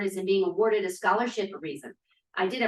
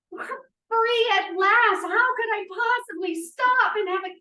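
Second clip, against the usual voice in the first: elated; 10 semitones higher, 5 dB louder, slower than usual. Acted. Ecstatic declaration of freedom.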